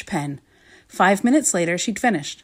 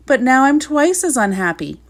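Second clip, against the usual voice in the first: wailing; 5 semitones higher, 5 dB louder than usual. Acted. King wailing about unhappiness.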